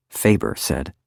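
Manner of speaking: usual speaking voice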